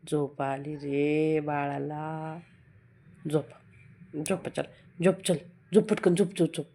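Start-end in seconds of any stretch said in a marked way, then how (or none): 0.1-3.4 soothing lullaby voice. Real, not acted. Lulling the baby to sleep.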